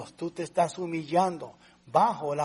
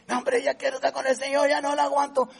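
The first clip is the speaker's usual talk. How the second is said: lightly voiced whining believer, higher and quicker; mock complainer; 8 semitones higher, 3 dB louder, faster than usual. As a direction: parody a weary grumbler. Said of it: "¿O por qué dices". (imitación ligera de un creyente quejoso)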